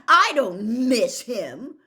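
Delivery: loud, slow, angry denial; stubborn boy shouting a lie. Acted. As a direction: deny it hotly, too hard. Harry angrily denying.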